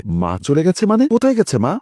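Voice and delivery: a sharp rise of disbelief on কোথায় গেছে মা; startled son asking where mother went; surprised. A narrator's reading. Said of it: "Munna অবাক হয়ে জিজ্ঞাসা করে". (অবাক হয়ে প্রশ্ন)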